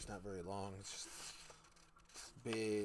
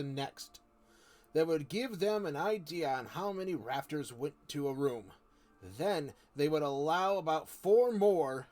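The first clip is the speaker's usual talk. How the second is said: louder, measured reading voice; story narration; 4 semitones higher, 9 dB louder, slower than usual. A narrator's reading. Streamer reading Narnia to viewers, storytelling voice. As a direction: read the chapter aloud steadily. Reads the book aloud.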